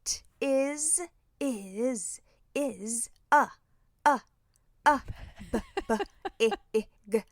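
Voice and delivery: halting letter-by-letter reading; sounding out words. Retold or imitated. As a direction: sound it out haltingly, like a child learning to read. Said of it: "And then she'll read".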